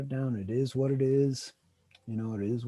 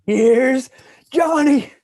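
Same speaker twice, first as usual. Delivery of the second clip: mock Shining quote; 8 semitones higher, 12 dB louder, much slower than usual. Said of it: "Shining quote while chainsawing door".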